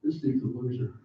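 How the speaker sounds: low, soft dismissive aside; mock scornful verdict. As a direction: sneer it under your breath. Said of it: "you're like".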